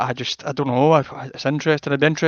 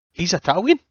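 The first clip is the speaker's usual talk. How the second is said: surprised question; 5 semitones higher, 3 dB louder than usual. Retold or imitated. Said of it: "And she went".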